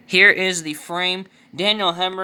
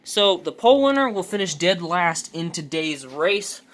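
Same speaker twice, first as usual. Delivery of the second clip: race caller; 4 semitones higher, 3 dB louder than usual. Acted. Live race play-by-play.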